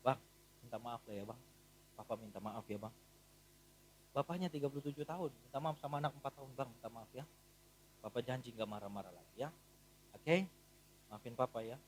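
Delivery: very soft, low gentle plea; hushed father saying sorry; tender apology. Retold or imitated. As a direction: coax a small child softly. Father apologizing to small son.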